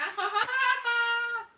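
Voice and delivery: a burst of high, loud laughter; real bright laugh. Real, not acted. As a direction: crack up at the joke. Laughs at the comment.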